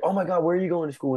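high-pitched mock-excited mom; imitated gushing mom. Mom quizzing seniors on the senior walk, imitated gushing. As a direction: mimic a mom's eager, high question. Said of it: "And the mom's like".

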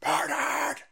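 high shouted mock outrage. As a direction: shriek it like a hysterical pundit. Mocking media outrage.

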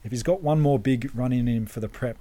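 usual speaking voice

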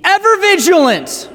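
bellowed warning blast; sudden shout. Acted. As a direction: yell it to startle the class. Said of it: "if I blew out your ears".

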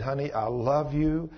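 slow, soft and tender, a husband's confession; hushed loving words to a wife; tender whisper-soft. Retold or imitated. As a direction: say it gently to a wife, softly and slowly. Husband tells wife through tears.

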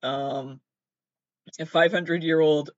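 ordinary talk

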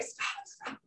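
imitating a tiny hushed refusal; whispered protest. Woman retelling how she brushed off sponsor's praise, whispered. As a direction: whisper it, shrinking from the compliment.

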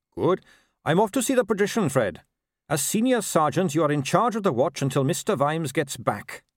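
high, earnest captain voice; keen young captain. Acted. Captain to constable about a new office system, keen and earnest. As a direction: reassure with upbeat official confidence. Captain handing over to sergeant.

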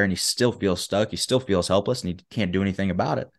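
ordinary delivery

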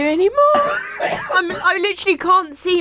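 breathless tearful laughter; laughing to tears. Real, not acted. Quizmaster laughing too hard to read the next question, laughing to tears. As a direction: choke the words out through real laughter. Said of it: "My eyes are blurry for tears".

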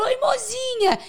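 very high cutesy pet voice; cooing to cats. Retold or imitated. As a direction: greet the cat in a tiny high voice. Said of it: "eu viro pros gatos e falo também". (voz fininha e melosa de falar com gato)

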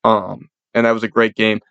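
ordinary talk

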